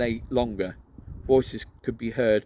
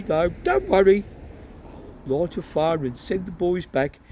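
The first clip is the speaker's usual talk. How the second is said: higher, chatty, sheepish explaining; cheerful, a bit embarrassed wizard; apologetic father; 7 semitones higher than usual. Acted.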